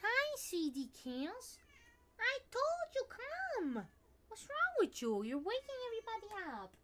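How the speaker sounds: high fond scolding. Real, not acted. Owner fondly scolds her noisy cat, high pet voice.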